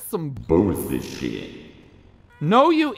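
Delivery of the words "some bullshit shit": mock deep voice. Fed-up player mocking the play, slow deep grumble. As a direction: drop way down, slow and disgusted.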